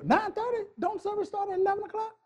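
high, soft questioning mimicry; mock reluctant churchgoer. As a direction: play the puzzled member, high and light. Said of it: "many of you ain't even thought about".

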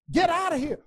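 high, loud angry order; angry dismissal. Acted. Pharaoh throwing Moses out, angry shout. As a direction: bark him out of the room.

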